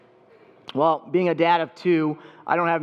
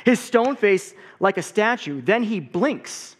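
put-on lively picture-book read-aloud for kids; story time reading; 3 semitones higher, slower than usual. A narrator's reading. Pastor reading a picture book to the congregation, lively read-aloud.

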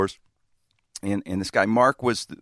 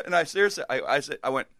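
normal talking voice

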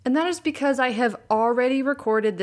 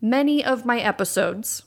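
ordinary talk, nothing marked

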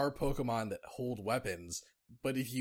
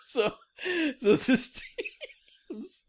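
laughing while talking; 13 semitones higher, 5 dB louder, much slower than usual. Real, not acted.

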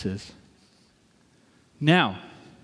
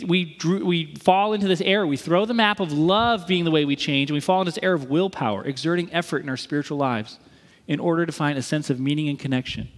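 ordinary delivery

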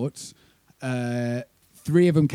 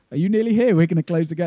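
high, louder phone voice of a ferry worker; ferry lady; 5 semitones higher, 5 dB louder, faster than usual. Retold or imitated. Ferry lady phones the late band, high brisk voice. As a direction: put on a higher, brisk official lady.

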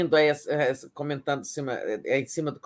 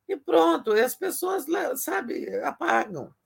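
much higher, exasperated; exasperated emphasis; 10 semitones higher than usual. Real, not acted. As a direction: throw up your hands at it. Mocking how people ignore alerts. (exasperado e enfático)

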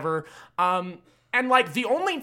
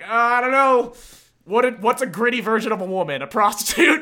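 mocking; 4 semitones higher, 6 dB louder than usual. Mocking the writers' thinking.

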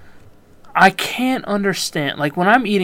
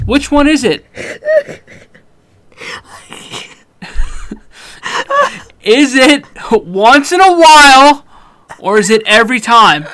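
loud, higher, hammed-up insistence; mock demand; 6 semitones higher, 7 dB louder than usual. Host loudly demands that Corey pick once or every time. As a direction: lean on every word, faking anger. Presses for answer, mock stern.

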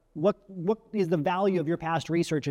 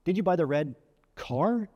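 "car" set apart by a pause and raised; pause, then punch "car" as a question; stress on CAR. Shown as a demonstration. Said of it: "And then finally".

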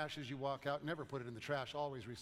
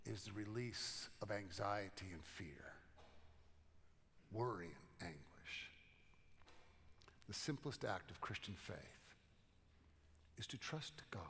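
hushed; 7 semitones lower, 8 dB quieter than usual. Real, not acted. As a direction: lower and softer, sinking to a whisper on the last words. Sermon sinks to a hush.

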